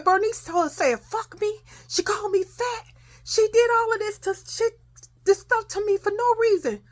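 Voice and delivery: high, plaintive list of wrongs; reenacted aggrieved complaint. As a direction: act out a child telling a parent everything. Upset child lists wrongs to mother.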